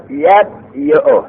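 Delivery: یبیع very slowly as yab-yi-u; uncontracted form. Shown as a demonstration. Teacher conjugating without contraction, یبیع drawn out as yab-yi-u. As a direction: break یبیع after yab, sound the y. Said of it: "اعلال نکن".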